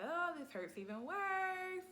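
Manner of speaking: high, slow pained whimper; reliving a labour pain, high and drawn out; pained whine. Retold or imitated. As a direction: plead through the pain, stretched out. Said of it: "I'm like".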